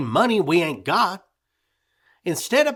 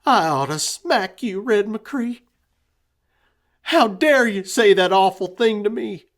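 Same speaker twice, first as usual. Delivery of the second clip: loud, higher wounded anger; hurt fury; 8 semitones higher, 11 dB louder than usual. A narrator's reading. Grieving mother scolding her husband, loud and wounded. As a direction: make him feel the grief.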